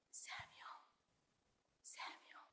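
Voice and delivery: whispered call, voiceless and very soft. Acted. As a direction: whisper the name gently, like God calling in the night. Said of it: "He talks very softly".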